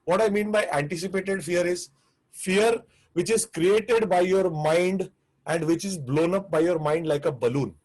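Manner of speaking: normal talking voice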